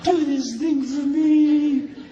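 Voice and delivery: slow, coaxing wifely sweet talk. Retold or imitated. Wife coaxing her husband into a chore, slow and sweet. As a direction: mimic her buttering up her husband, slowly.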